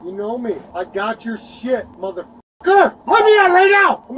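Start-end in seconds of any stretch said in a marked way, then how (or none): 2.7-4.0 slow, loud, high yell; real drawn-out angry shout; shouted demand. Real, not acted. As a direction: holler it as a furious order. Detainee demands to be released.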